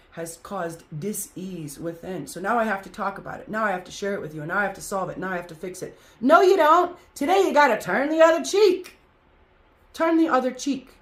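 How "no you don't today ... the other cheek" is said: high, loud, forceful no; emphatic outburst. Real, not acted. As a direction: cut them off loudly and firmly. Urging listeners to stop complaining.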